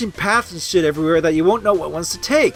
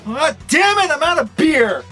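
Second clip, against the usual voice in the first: raging gamer; 6 semitones higher than usual. Pretend. Comic outburst, beer gone.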